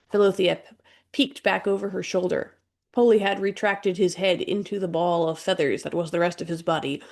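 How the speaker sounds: ordinary delivery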